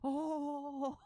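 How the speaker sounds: high, loud moan; dismayed ratling. Acted.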